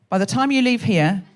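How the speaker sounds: usual speaking voice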